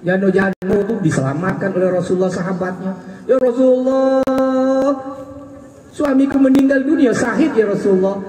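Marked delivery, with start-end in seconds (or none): 3.3-8.2 pleading widow. Acted.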